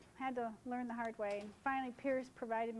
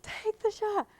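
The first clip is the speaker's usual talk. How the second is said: urging myself; 8 semitones higher, 6 dB louder, slower than usual. Retold or imitated. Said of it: "I'm going".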